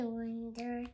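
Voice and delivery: usual speaking voice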